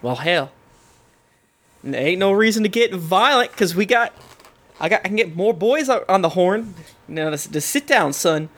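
put-on folksy Southern lawman accent. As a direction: talk someone down in a Southern constable's accent. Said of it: "has a southern accent saying that".

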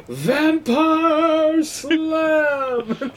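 high, drawn-out vampire shout; vampire battle cry. Acted. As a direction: stretch out the attack name like a cartoon villain. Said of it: "Not, not my vampire voice at all".

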